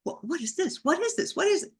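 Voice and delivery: high, rapid-fire bewildered questioning; bewildered inner thought. Retold or imitated. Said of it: "thinking to myself in my brain".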